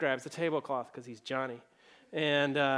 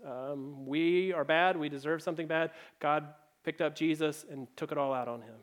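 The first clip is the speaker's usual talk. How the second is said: ordinary talk, nothing marked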